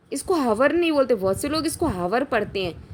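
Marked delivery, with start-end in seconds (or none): none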